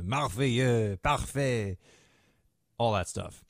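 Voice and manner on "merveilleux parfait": slow, mock French accent on merveilleux; light French accent, drawn out; hammed-up French. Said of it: "the French and the harpsichord".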